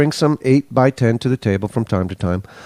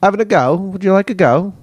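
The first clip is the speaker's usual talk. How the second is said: lightly put-on Aussie twang, higher; playful higher Aussie lilt on having a go; mock Aussie; 7 semitones higher than usual. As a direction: tease the Aussie phrase in a high mock accent. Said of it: "I've even thought about having a go".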